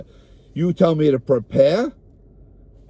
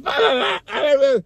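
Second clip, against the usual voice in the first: high, shouted squawking cackle like a chicken; chicken cackle imitation; 10 semitones higher than usual. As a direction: mimic a family of crackling chickens. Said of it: "we sound like chuckling, crackling chickens".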